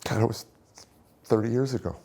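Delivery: halting, fighting back tears at a painful memory; really overcome, pushing the words out; choked up. Real, not acted. Chokes up recalling wife sobbing.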